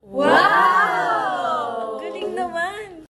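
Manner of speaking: amazed. Acted. Kid sees the magic lantern.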